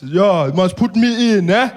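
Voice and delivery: menacing slow greeting, weighing each word; Malema voice. Retold or imitated. Malema greets the comedian at his table, slow and menacing.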